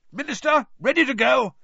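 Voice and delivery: bursting with eagerness; excited. A narrator's reading. Excited Bagman voiced.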